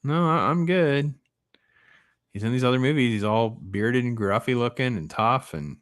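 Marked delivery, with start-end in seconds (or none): 0.0-1.1 higher-pitched, drawn-out mock refusal; polite refusal. Viewer acts out declining to mess with a tough guy, high-pitched. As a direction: back away with a high, careful no. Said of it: "but I'm like".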